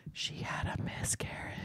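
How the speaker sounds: hushed whisper, no voice. Retold or imitated. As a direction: whisper it like a secret. Quoting secret told in bedroom.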